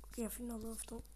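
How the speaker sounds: hushed aside; whisper. Real, not acted. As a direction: whisper it while sneaking. Ψιθυρίζει στο παιχνίδι. (ψιθυριστά)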